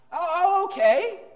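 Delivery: high, eager caving husband; hammed-up pushover husband; mock eager husband. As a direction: play the spineless yes-man.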